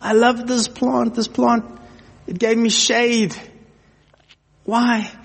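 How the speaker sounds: high-pitched, hammed-up wail; mock crying. Preacher acting out Jonah pleading with God over his plant, mock crying. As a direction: wail in a put-on high voice, drawing out the plea. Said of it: "Jonah is crying".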